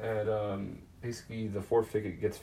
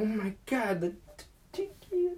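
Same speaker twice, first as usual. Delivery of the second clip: excited squeal; 13 semitones higher than usual. Retold or imitated. Charlie finding the golden ticket, in a high excited squeal. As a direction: shriek it with sudden joy.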